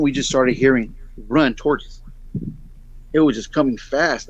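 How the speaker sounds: ordinary talk, nothing marked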